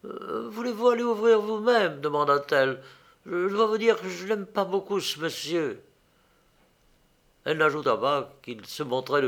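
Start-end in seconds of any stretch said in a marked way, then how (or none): 0.1-2.0 put-on high, hesitant young woman; a little embarrassed. A narrator's reading. Young woman asks Philippe to open the door, embarrassed. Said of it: "Ida sembla un peu embarrassée". 3.3-5.8 high, stammered confession; a little embarrassed. A narrator's reading. Young woman confesses she dislikes the visitor, embarrassed. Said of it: "Ida sembla un peu embarrassée".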